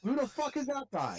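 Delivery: high, slow disbelief; drawn-out outraged question; incredulous. Real, not acted. Outraged repeat, slower.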